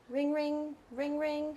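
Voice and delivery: softly whispered ring ring; mock phone ring. Therapist whispering a pretend phone ring before the role play. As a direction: whisper the phone ringing like a toy. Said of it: "pretend phone rings".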